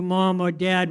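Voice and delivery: ordinary talk, nothing marked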